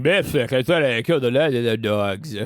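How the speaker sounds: deep barbarian voice, half indifferent; low apathetic mutter. Acted. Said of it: "muttered Grigner in a half apathetic state".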